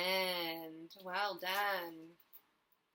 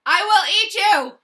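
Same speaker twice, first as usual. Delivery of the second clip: much louder, higher roaring threat; chasing shout; 13 semitones higher, 22 dB louder than usual. Acted. Dragon chasing the boy, roaring loudly. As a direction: bellow it while giving chase.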